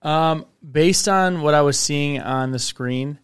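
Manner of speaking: normal talking voice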